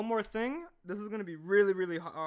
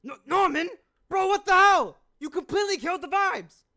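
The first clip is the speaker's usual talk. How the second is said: high, loud exasperated shouting; outraged yell; 12 semitones higher, 11 dB louder than usual. Acted. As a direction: yell at a friend who ruined everything. Outraged character in voice acting takes.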